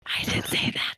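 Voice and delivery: hushed whisper, half laughing. Real, not acted. Player hurriedly whispering a denial to the DM. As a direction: whisper it so the table won't hear.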